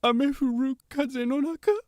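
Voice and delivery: high sobbing quote; mock sobbing. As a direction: imitate crying through a language app line. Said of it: "just absolutely sobbing".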